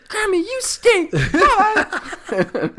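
put-on very high little-kid voice; imitated little girl. Little girl leaving grandmother a voicemail, high mimicking voice. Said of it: "a little girl leaving her grandmother a voicemail".

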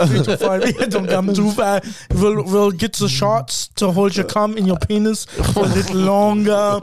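comic German prison official; German accent. Pretend. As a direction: explain it in a put-on German accent. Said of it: "your German accent".

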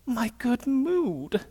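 very slow, soft, crushed sigh of a line; mock sorrow. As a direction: imitate the wife's deflated lament. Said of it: "with sorrow in her face and goes".

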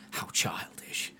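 a scornful whisper to himself; muttered aside. Retold or imitated. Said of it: "he steps away and he's like".

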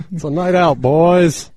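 loud, high hyped bro call; rally the boys, hammed up; bro party voice. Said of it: "they were like".

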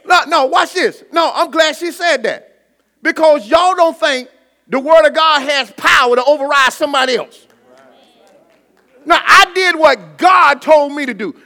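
loud, high-pitched sermon shout; full-force preaching. Real, not acted. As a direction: drive it home to the congregation at full volume.

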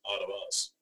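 normal talking voice

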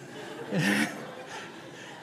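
soft unvoiced giggle; giggling to himself under the tractor; breathy giggle. Real, not acted.

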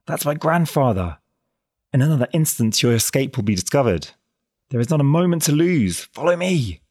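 high, loud and urgent; alarmed. A narrator's reading. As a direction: press him on in panic. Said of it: "exclaimed the girl in alarm".

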